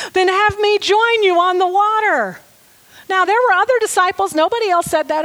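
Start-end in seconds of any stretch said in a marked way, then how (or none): 0.1-2.4 eager. Retold or imitated. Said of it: "Peter shouted".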